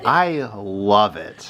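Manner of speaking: very slow, stretched-out gushing; savoring. Retold or imitated. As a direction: draw out LOVE with relish. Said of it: "the way you say it".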